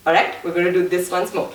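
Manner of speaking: ordinary talk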